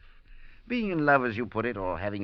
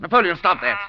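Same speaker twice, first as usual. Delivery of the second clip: loud, higher command at the bird; sharp shout; 8 semitones higher, 11 dB louder than usual. Acted. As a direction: snap the bird into silence, loudly. Master yells at screeching parrot.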